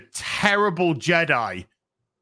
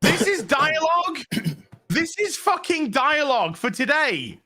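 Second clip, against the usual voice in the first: incredulous rant; 10 semitones higher than usual. Real, not acted. Ranting at bad dialogue.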